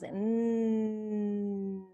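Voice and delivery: "Mm" said as a long steady "nnn"; N sound. Shown as a demonstration. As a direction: hold a nasal N hum, tongue tip up. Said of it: "shoot the air out your nose".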